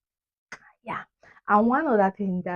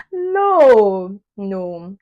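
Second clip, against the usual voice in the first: emphatic refusal; 12 dB louder than usual. Real, not acted. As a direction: wail the refusal loudly. Rejecting the fake accent idea.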